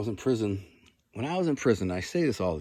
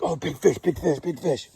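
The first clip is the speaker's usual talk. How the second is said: excited inner voice; 7 semitones higher than usual. Retold or imitated.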